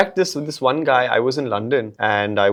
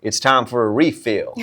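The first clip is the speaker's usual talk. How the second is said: put-on Southern accent; 6 dB louder than usual. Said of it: "a little bit of Southern accent".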